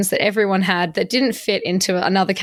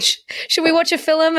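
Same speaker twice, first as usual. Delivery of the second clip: Scottish housemate; 7 semitones higher than usual. Retold or imitated. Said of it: "My old housemate was Scottish".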